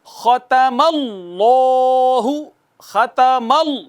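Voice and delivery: drawn-out tajweed recitation of ختم اللہ with heavy لام; slow recitation. Shown as a demonstration. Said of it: "پہلے زور سے مارا".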